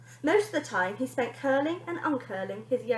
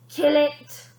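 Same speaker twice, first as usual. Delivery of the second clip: louder repeated command; demanding; 3 semitones higher, 7 dB louder than usual. Acted. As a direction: insist the spider dies. Bonnie demands again.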